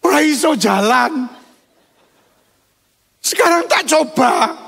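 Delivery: put-on a cheeky shepherd boy teasing the giant; David, unafraid. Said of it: "Daud tidak menangis dan bilang".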